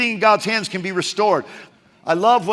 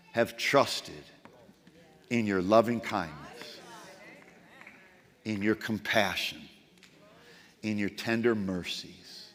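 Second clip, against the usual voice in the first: tender trust; 11 semitones lower, 8 dB quieter than usual. Acted. Preacher reading David's turn to trust, hushed and tender. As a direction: breathe it out as quiet surrender. Psalm turns to quiet trust.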